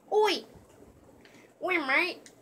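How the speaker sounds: loud, high mock-British call; bad British accent. Gamer goofing around during a match, terrible British accent. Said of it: "the worst British accent I've ever had".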